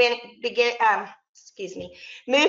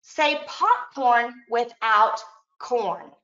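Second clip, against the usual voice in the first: slow teacher prompt; much slower than usual. Shown as a demonstration. Teacher giving a slow deletion prompt to students, slowly. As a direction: draw out the instruction slowly for young students. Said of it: "Now I'd say".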